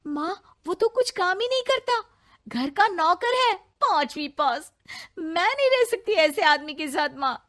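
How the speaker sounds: tearful complaint. Acted. Daughter complains tearfully to mother.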